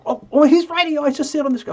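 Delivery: sudden high surprised oh, oh. Real, not acted. Host spots a viewer typing on screen, surprised. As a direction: caught off guard, voice jumps way up.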